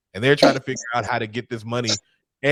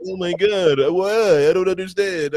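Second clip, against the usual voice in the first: mock innocent; 5 semitones higher than usual. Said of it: "I'd be like".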